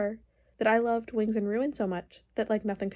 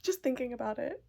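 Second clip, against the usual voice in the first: ordinary talk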